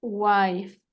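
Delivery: wife said alone as "waif"; pronunciation demo. Shown as a demonstration. Teacher showing how it is pronounced, careful model. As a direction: model wife as one full, drawn-out syllable. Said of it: "Se escribe así y se pronuncia".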